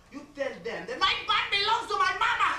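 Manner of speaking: high, loud motherly battle cry; defiant shout. Acted. Mother coaching her son for jail, defiant shout. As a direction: build to a belted-out declaration.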